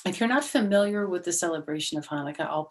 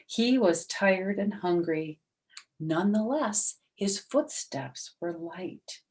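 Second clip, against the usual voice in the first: lively storybook reading voice; story narration; 4 dB louder than usual. A narrator's reading. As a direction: read it out like a children's story. Reads story aloud.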